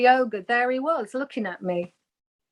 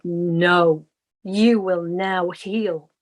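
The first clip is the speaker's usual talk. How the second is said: slow, lower, otherworldly voice giving a firm command; a calm unseen spirit, slow and lower; voice from nowhere; 4 semitones lower, much slower than usual. Retold or imitated. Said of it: "this voice from nowhere said".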